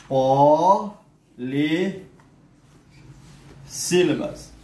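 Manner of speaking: Polissílabas drawn out into separate beats; syllable by syllable. Shown as a demonstration. Teacher to class, dividing Polissílabas into syllables, very slow. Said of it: "teacher sounds out syllables".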